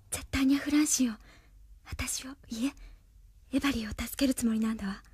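breathless whisper to herself; whispered thought. Acted. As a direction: whisper it as a secret realization. Krishna's private thoughts. (独り言のささやき)

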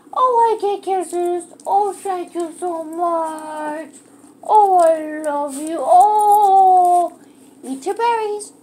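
gushing pet talk. Real, not acted. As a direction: gush over the dog's kisses with real delight. Dog gives kisses, owner gushes.

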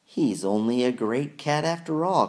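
high loud mocking cry; jeering creature crying out. A narrator's reading. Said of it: "cried one".

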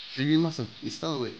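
ordinary talk

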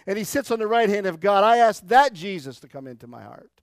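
a loud, ringing confession of faith; preaching at full force. Acted. Pastor proclaiming the creed, preaching at full force. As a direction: proclaim it to the back row.

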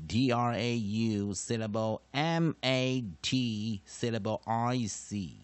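slow, loud letter-by-letter D R A U, syllable, M A T; spelling out. Shown as a demonstration. Teacher breaking traumatic into syllables, slow emphatic spelling. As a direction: spell each letter deliberately, marking every syllable break.

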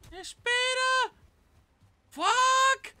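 very high, loud yells; real shrieking panic, words stretched out; panicked screaming. Real, not acted. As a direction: scream in alarm at an ambush. Panicking in game ambush.

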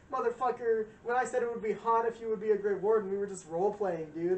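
loud, high ranting wife; hammed-up yell at a runaway husband; mock angry wife. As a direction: chew him out as the furious wife. Wife yelling at Oghren, retold.

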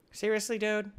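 high, disbelieving seriously; incredulous. Real, not acted. Gamer reacting as a player runs past. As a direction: squeak it out in disbelief.

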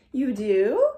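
ordinary delivery, nothing marked

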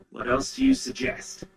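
normal talking voice

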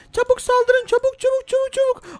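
high, urgent rallying call; bully gang leader. Pretend. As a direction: egg the gang on to attack. Bully urges attack.

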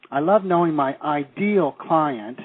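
slow line with "ideal" stretched and underlined; double-underline "ideal" with your voice; stress on ideal. Shown as a demonstration. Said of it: "emphasis on the word ideal".

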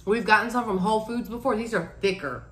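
muffled through chewing; mumbled with mouth closed; mouth full, chewing. Real, not acted. Seen 3: Both women chew mochi throughout; unclear which one says "mm-hmm.

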